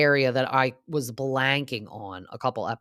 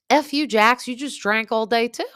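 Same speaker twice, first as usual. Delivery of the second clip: much higher, louder, hurling it back at him; mock furious retort; 10 semitones higher, 7 dB louder than usual. Brittany yelling back at Jax for judging her drinking, high angry shout. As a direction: snap at him, pitch up high and loud.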